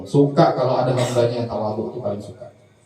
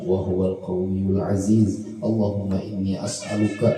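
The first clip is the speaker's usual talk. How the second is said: recited prayer; 4 semitones lower, slower than usual. Acted. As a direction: intone the dua slowly and devoutly. Recites closing supplication.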